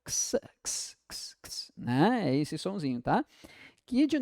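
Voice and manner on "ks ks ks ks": ks, ks, breathed out unvoiced; voiceless ks repeated; whispered ks sound. Shown as a demonstration. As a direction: hiss out ks. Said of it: "O som de ks".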